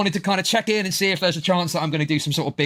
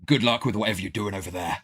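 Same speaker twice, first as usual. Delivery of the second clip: much lower, deadpan cool-guy voice; lowered mock of his own cool reply; 8 semitones lower than usual. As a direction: play it like John Wick brushing someone off. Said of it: "And then I said".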